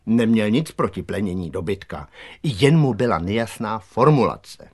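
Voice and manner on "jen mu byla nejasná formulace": ordinary delivery, nothing marked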